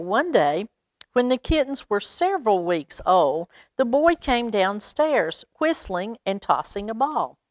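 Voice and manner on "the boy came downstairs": usual speaking voice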